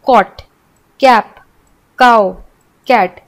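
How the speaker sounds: कॉट, कैप, काऊ, कैट each said with a hard k sound. Shown as a demonstration. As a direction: say each word slowly with a clear k sound. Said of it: "सी का उच्चारण क के रूप में". (धीरे-धीरे क की ध्वनि के साथ)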